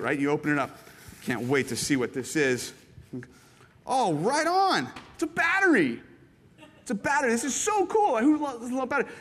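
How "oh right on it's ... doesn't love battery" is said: overjoyed at a plain battery; overjoyed thanks. Retold or imitated. Thrilled by a battery gift.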